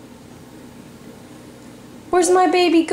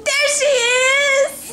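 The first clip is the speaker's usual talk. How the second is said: slow, higher excited reveal; playful peekaboo; 7 semitones higher, slower than usual. Real, not acted. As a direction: draw out the surprise. Peekaboo with baby.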